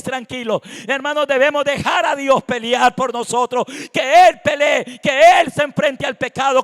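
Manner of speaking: loud, insistent pulpit delivery; full-force preaching. Real, not acted. Preacher urging the congregation to let God fight, full-force preaching. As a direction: drive each phrase home to the congregation.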